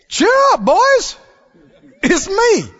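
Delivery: high, loud call across the water; Jesus calling out. Acted. Jesus calling to the scared disciples across the water, loud and high. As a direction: call out to the frightened boys. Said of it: "Jesus spoke up and he said".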